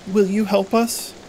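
put-on a small girl asking strangers for help; little girl. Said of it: "the girl says".

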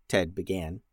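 usual speaking voice

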